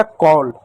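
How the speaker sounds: कॉल with an open "aw" vowel; aw pronunciation. Shown as a demonstration. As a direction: say कॉल clearly as "kawl". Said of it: "ओ के रूप में करेंगे". (कॉल में ऑ की मात्रा का उच्चारण)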